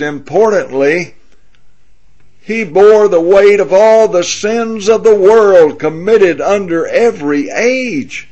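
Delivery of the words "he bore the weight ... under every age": rising to a loud, higher-pitched preaching peak; preaching climax. Real, not acted. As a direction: lift it into a full-voiced sermon climax. Preacher climaxes on Christ's burden.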